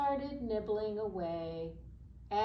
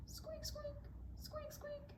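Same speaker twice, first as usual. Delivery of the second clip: tiny high squeaks, very soft; mouse squeaks; 13 dB quieter than usual. Acted. The scared mouse squeaks at the lion, tiny and high. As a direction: squeak like a frightened little mouse. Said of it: "the little mouse squeaked".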